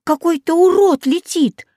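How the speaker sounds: high-pitched alarmed exclamation; crying out. A narrator's reading. Katya sees a monster flying, crying out. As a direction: call out the sighting, voice pitched high. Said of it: "закричала Катя".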